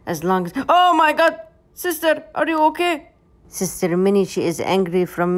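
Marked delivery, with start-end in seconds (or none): 0.7-3.1 high, loud startled cry; alarmed cry. Real, not acted. Owner seeing cat fall, crying out in alarm. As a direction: shout out in sudden alarm.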